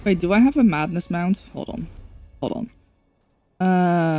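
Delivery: ordinary talk, nothing marked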